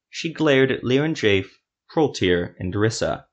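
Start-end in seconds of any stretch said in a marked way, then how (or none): none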